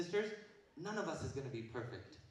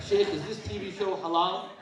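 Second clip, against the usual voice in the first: 1 laughing mock; 2 semitones higher, 10 dB louder than usual. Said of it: "it's like".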